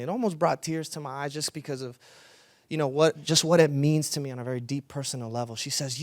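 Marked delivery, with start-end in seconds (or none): none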